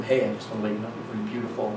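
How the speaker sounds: low, soft, quick laid-back line; cool surfer dude. Retold or imitated. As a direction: imitate an effortlessly cool surfer. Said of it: "this cool blonde surfer dude".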